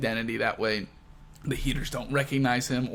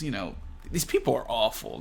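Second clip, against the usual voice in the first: ordinary talk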